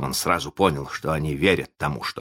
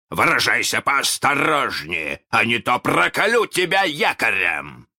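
Captain Hook; 8 semitones higher, 4 dB louder than usual. A narrator's reading. Peter threatens Hook in Hook's voice, loud. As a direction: mimic a captain threatening, pitch climbing. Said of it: "ответил голос".

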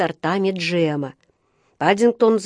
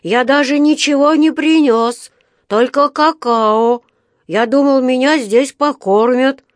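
high, loud little bear, earnest; Paddington the bear cub; 6 semitones higher, 6 dB louder than usual. A narrator's reading. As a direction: protest innocently in a raised voice. (высокий громкий голос медвежонка)

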